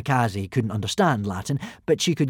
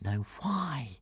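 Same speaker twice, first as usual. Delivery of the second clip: puzzled murmur; 10 dB quieter than usual. A narrator's reading. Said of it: "frowning to himself".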